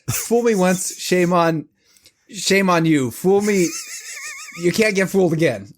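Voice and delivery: a hint of George W. Bush drawling the saying; mock presidential quote; George W. Bush impression. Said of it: "as the wonderful George W. Bush once said".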